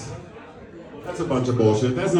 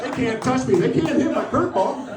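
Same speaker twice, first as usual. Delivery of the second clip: gloating coach getting higher and pumped up; cocky pitcher; 6 semitones higher than usual. Retold or imitated. Coach dad gloating on the mound, pumped up. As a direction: strut about the strikeouts. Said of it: "one time I was like".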